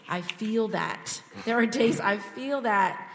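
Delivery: ordinary talk, nothing marked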